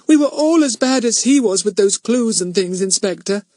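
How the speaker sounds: put-on young girl begging the inspector, anxious and high; anxious little girl. A narrator's reading. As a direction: plead for a friend in a small high voice. Girl pleads for Fatty.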